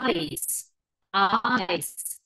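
"Eyes" as aaa-is with a drawn-out glide; long I in ice. Shown as a demonstration. Teacher models the word for ice, long ay in "Eyes". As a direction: stretch the ay of "Eyes", ending in s.

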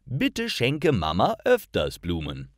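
ordinary talk, nothing marked

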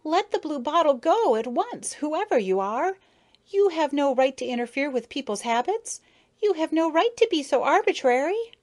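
high-pitched, indignant outcry; impulsive cry. A narrator's reading. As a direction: cry out in protest without thinking. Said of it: "Without thinking, she cried".

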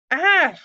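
loud, high yelped scream; sudden acted shriek; scream of pain. A narrator's reading. Hobgoblin bitten by the griffin, screaming. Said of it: "Hogsqueal screamed".

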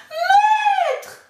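very high, loud sung cry; the golden harp crying to its master; harp crying for help. Acted. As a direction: call out shrilly like a magic harp. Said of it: "appelait l'harpe".

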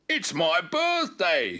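high, drawn-out excited cry; barked. A narrator's reading. Spot seeing the surprise, barking happily. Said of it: "he barked".

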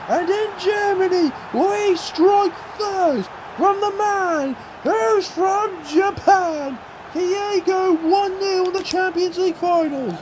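high, slow, elated broadcaster call; hyped commentary. Real, not acted. Fan calling his own goal like a broadcaster, elated.

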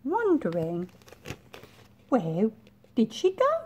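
plain reading voice

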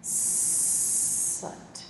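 hissed Sat, long unvoiced s; drawn-out s like a snake. Shown as a demonstration. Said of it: "make it sound like a snake".

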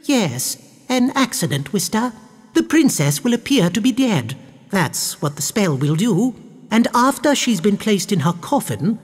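high-pitched, discreet plotting; scheming baroness. A narrator's reading. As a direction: explain the scheme to a servant. Baroness explains the plot.

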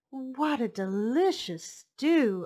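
slow, delighted character voice; savoring. Acted. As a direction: savor each word. Omu tastes her stew.

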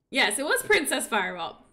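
usual speaking voice

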